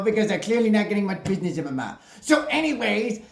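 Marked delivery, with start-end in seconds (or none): none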